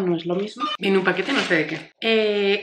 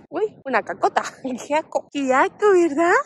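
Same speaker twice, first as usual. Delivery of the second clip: dopey voice; 5 semitones higher than usual. Real, not acted. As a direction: coo at the dog, grossed out. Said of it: "esta voz de tontorrones cuando hablamos con nuestros perros".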